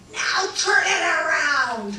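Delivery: high, slow panicked shout; frantic cry to turn back; screamed. Retold or imitated. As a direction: yell it in panic at the pilot. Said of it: "I screamed".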